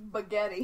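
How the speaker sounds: "spaghetti" said as "puh-ghetti"; mispronounced spaghetti. Shown as a demonstration. Friend clarifying the word just said, "spaghetti" without its S. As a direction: drop the S of "spaghetti", puh-GHET-ee. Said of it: "No, I said pu".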